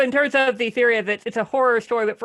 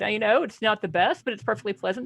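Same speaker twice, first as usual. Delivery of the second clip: ordinary talk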